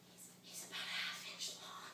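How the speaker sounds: hushed, voiceless description; whisper. Acted. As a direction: whisper it to the emergency operator. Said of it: "they whisper it so".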